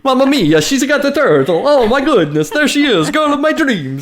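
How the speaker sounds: high, hammed-up Italian accent; playful Italian accent, pitched high; mock Italian accent. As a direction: ham up a cartoon Italian accent. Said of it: "I can't do an Italian accent".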